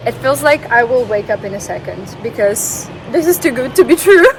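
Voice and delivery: rising to a high, loud squeal on "to be true"; delighted disbelief squealing high; excited squeal. Real, not acted. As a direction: let the joy burst out at the end. View too good to believe.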